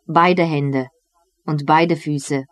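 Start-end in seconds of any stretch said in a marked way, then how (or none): none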